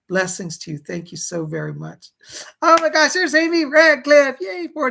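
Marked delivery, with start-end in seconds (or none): 2.6-4.9 loud, high, thrilled shout; excited greeting. Real, not acted. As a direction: cheer the donor's name out loud. Greeting a donor on stream.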